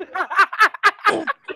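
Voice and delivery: breathy high laugh; real laugh. Real, not acted. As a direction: giggle at being talked into it.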